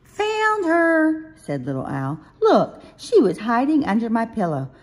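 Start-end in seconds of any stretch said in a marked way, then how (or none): 0.0-1.4 high, loud, stretched-out announcement; delighted little owl. Acted. Little Owl tells Mommy Hedge is found, excited. Child owl finds lost toy. 2.4-4.7 put-on high, loud childlike explanation; excited little owl. Little Owl found Hedge under the pillow, excited.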